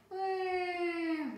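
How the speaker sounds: put-on high, loud child's plane sound; aeroplane noise. A narrator's reading. Baby Bear playing aeroplane in bed, high plane noise. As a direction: zoom like a toy aeroplane. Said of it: "went Baby Bear".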